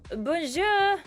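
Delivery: a touch of French flair on bonjour; French accent. Acted. Lyle greeting Iris across the pool, in a French accent. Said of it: "Is he French?".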